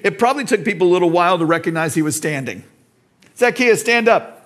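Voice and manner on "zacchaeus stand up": high mocking call; teasing crowd. Crowd teasing short Zacchaeus, high mocking call. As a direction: tease the short man to stand.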